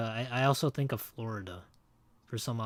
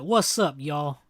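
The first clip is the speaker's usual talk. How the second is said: a hammed-up Southern accent to mock how some people talk; 4 dB louder, slower than usual. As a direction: ham up a Southern greeting, poking fun. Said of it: "that type of Californian accent".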